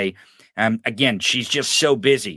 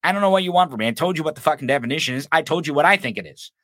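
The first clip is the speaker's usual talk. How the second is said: usual speaking voice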